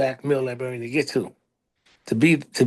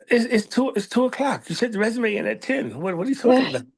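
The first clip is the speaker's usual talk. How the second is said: mildly exasperated wife, pitched up; higher-pitched mock exasperation; incredulous wife; 8 semitones higher than usual. Acting out his wife's incredulous retort.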